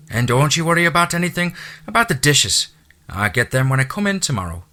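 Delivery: a chatty Irish housekeeper's brogue; thick Irish brogue. A narrator's reading. Irish housekeeper welcoming the new owner, thick Irish brogue. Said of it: "her lyrical accent".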